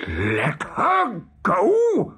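slow, high, incredulous roar; outraged cry. A narrator's reading. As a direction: drag out each word in disbelief. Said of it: "cried Hook".

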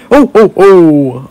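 slow, high, loud ho-ho-ho; Santa laugh. Acted. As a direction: stretch out a big jolly Santa laugh. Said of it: "I'm good at making Santa laugh".

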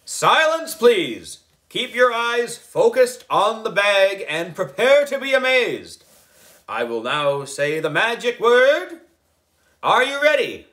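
high, loud showman's command; grand wizard voice. A narrator's reading. Boy wizard hushing the party kids, high booming wizard voice. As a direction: boom it out like a stage wizard. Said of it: "In my best wizard voice".

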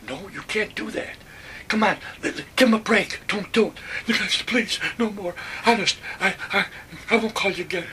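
high, pressing pleas; urgent begging. Retold or imitated. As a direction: beg him to give the man a break. Speaker begging friend to stop.

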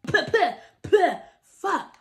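choking on a chip mid-word; sputtering cough. Real, not acted.

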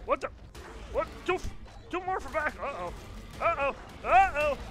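frantic high yelling, fleeing for life; panicking gamer. Real, not acted. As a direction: shout in rising panic, trying to escape. Cornered by monsters, fleeing in game.

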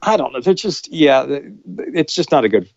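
normal talking voice

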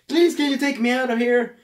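high, pleading plea for rescue; pleading astronaut. Retold or imitated. Trapped astronaut begging the boss to get her out, pleading. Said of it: "one of the people are like".

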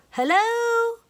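high calling-out hello; hollering. A narrator's reading. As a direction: call into the house, high. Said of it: "Leola hollered".

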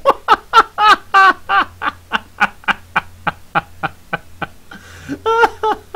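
long shrill fearful cackle; high shrieking laugh. Real, not acted. Gamer shrieking with laughter at the scare. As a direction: squeal with laughter. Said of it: "crying out of fear".

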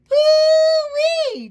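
very high, loud whoop of delight; gleeful whoop. Acted. Truck crowing over its stink, loud whoop. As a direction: whoop like you're thrilled with yourself.

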